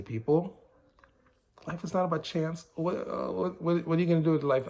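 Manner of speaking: usual speaking voice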